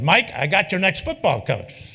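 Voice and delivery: insistent imitation. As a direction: hammer it home like a stubborn coach.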